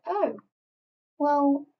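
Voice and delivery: mock French shrug. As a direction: toss it off as the playful voice of France. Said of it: "they're like".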